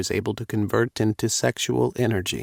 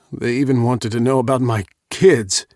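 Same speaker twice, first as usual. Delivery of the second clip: angry; 3 semitones higher, 5 dB louder than usual. A narrator's reading. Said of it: "just as angry as me".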